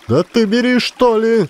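very high shouted urging; impatient shout. A narrator's reading. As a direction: yell at the hesitant boy. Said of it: "закричала опять баба". (баба нетерпеливо кричит на Кольку)

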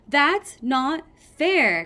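loud, high, slow protest; speaking up. A narrator's reading. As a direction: stand up and object, stretching the words. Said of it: "Clay spoke up".

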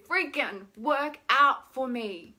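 loud, slow exasperated whine; drawn-out exasperated complaint; really whiny. Shown as a demonstration. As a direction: whine it out with force. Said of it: "And get really whiny".

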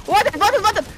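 high, loud urgent callout; excited shout. Real, not acted. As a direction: yell out the target. Shooting an enemy.

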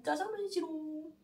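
quick, sing-song teasing ta-da; playful reveal. Real, not acted. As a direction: show off the dish like a cheeky surprise. Playful reveal of finished dish.

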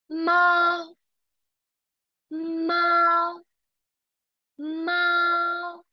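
猫 held high and level, first tone māo; teacher stressing first tone māo, not mā; high level tone mao. Shown as a demonstration. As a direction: sustain 猫 high and flat. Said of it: "千万别读成妈".

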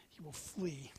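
hushed prophetic whisper. Acted. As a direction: drop to a whisper for the ending.

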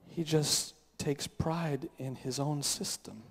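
low, subdued confession with long pauses; understated tearful hurt. Acted. Wife confiding she feels trapped in her marriage, subdued and sad. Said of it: "begin to cry and to say".

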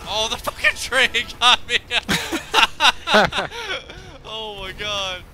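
loud, high laughing outcry; laughing shout. Real, not acted. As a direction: yell it, then crack up laughing. Killed by the train.

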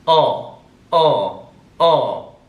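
ordinary talk, nothing marked

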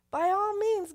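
high, loud royal voice; king's eager reply. Acted. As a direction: burst out as the eager king. King replies in scripture reading.

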